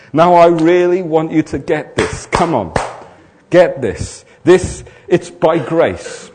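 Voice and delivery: loud, driving sermon voice; preaching at full force. Acted. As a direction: preach it hard to the room. Preaching to the congregation.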